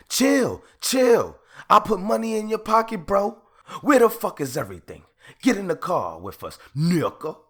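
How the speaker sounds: high, loud, fast yelled commands; hammed-up carjacking yells; mock carjacker shout. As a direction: bark it like the robbers on the tape. Reenacting carjacking audio recording.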